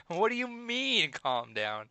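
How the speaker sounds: high, slow outraged question; indignant. Shown as a demonstration. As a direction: act offended at being told. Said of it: "That's a good reaction whenever anyone says".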